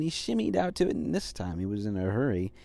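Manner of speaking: usual speaking voice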